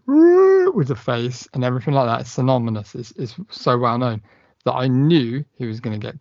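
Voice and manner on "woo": very high, loud mock scream; imitating the famous pod-person scream; imitated alien shriek. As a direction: let out a piercing alien wail. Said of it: "looking and going".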